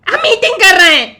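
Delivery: frantic, loud pleading; panicked shouting. Acted. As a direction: yell in panic. Panicked denial at gunpoint.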